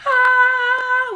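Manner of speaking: a loud, high shocked cry of pain; imitated panicked cry. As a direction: mimic the kid frozen in panic, crying out. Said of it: "he's just sitting there going".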